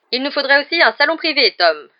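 loud, dry order; curt. A narrator's reading. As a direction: order it curtly, with authority. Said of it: "dit sèchement Cornelius Fudge".

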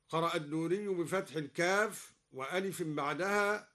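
ordinary talk

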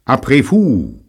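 high, loud French phrase; Parisian accent. A narrator's reading. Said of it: "mit seinem unnachahmlichen Pariser Akzent".

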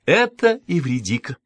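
high, lilting self-introduction; bird-of-paradise singsong. A narrator's reading. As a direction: announce yourself like an exotic songbird. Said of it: "пропела она голосом райской птицы".

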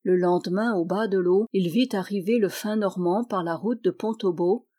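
ordinary delivery

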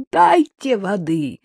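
loud desperate cry for water; woman yelling at her captors through the door; shouting. A narrator's reading. Woman locked in a cabin shouting for water. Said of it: "закричала женщина".

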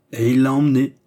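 cold confirmation. A narrator's reading. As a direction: coolly drive the fact home. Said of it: "dit froidement Cagliostro".

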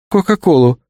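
ordinary delivery, nothing marked

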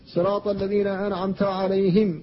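"عليهم" with i on the ه, "عليهِم"; alayhim reading. Shown as a demonstration. Teacher recites the second reading. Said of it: "كلها قراءات".

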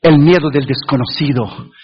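ordinary talk, nothing marked

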